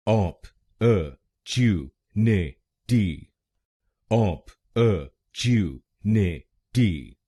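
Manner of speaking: ऑप, अ, chew, ने, टी split apart and drawn out slowly; slow syllable by syllable. Shown as a demonstration. Teacher breaking the word into slow separate syllables. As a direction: spell it out slowly, one syllable at a time. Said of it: "तीसरे शब्दांश पर यानी TU पर जोर डालना है".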